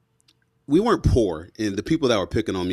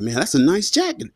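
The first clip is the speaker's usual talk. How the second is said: higher, impressed; admiring kids; 8 semitones higher than usual. Retold or imitated. Kids on the bus praising his jacket, higher, impressed. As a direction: compliment it like a wowed kid. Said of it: "And they're like".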